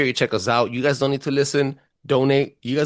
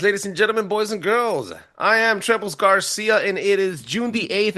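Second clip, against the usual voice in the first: announcer voice; 10 semitones higher than usual. Acted. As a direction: announce it like a ringmaster. Show intro announcer voice.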